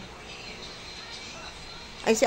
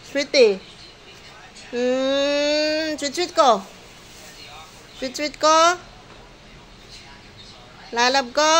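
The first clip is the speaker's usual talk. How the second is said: higher, sing-song coaxing voice throughout; 5 semitones higher than usual. Real, not acted. Caregiver cooing at elderly Sweetie, higher sing-song baby talk.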